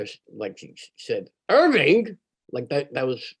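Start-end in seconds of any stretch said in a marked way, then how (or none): 1.5-2.2 high, louder cry of protest on the name; shocked mother. Retold or imitated. Mother objecting to her husband's plan, shocked outcry. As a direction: exclaim it like an appalled wife.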